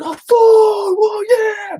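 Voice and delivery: high, loud drawn-out hollering; imitated cheering. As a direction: mimic excited passersby hailing him. Imitates passersby hailing him.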